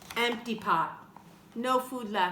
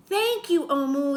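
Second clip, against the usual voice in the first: high loud happy thank-you; hot dog vendor; 4 semitones higher, 7 dB louder than usual. Acted. Vendor thanking Omu for the stew, high and loud. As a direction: thank her with glee, pitched up. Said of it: "the hot dog vendor said".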